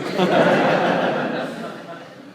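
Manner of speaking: a long, warm laugh. Real, not acted. Speaker laughing after the punchline.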